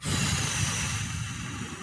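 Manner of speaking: empty sigh, long and slow; long exhale sigh. Shown as a demonstration. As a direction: breathe it all out in a sigh. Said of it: "exhaling it all out first".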